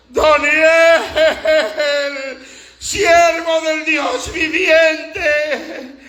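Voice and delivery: high, slow mournful cry; long wailing shout into the pit; sad calling voice. Acted. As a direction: call out in anguish, stretching every word. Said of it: "llamó a voces a Daniel con voz triste".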